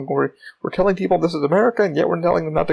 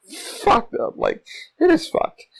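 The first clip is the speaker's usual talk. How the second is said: exasperated outburst; 13 semitones higher, much slower than usual. Real, not acted. Emphatic rant about New York.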